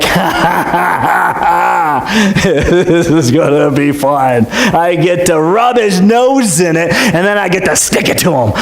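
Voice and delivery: slow, cackling Haman laughing at his own plan; gloating villain laugh. Retold or imitated. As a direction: laugh like a schemer who has won. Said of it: "And Haman would be like".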